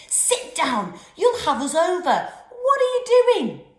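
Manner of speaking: shouting. A narrator's reading. Giles shouting at Kelly in the canoe. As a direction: yell at her to stop rocking. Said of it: "He was shouting at Kelly".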